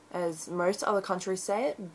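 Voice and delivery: ordinary delivery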